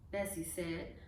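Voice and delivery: ordinary delivery, nothing marked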